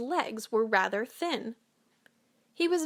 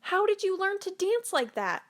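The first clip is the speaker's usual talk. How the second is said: eager question; 9 semitones higher than usual. Acted. Animals marvel, ask Gerald.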